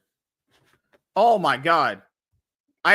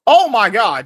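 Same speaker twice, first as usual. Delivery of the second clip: shocked outburst; 6 semitones higher, 12 dB louder than usual. Real, not acted. Gamer reacts to enemy combo.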